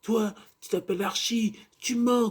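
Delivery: high-pitched, hammed-up accusation; squeaky mock denunciation; mock accusing friend. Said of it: "t'as un ami d'Archie qui fait".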